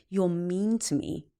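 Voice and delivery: slow, deliberate quoting of her words; husband calmly quoting his upset wife, slowly; slow quote. Retold or imitated. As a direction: repeat it back carefully, word by word.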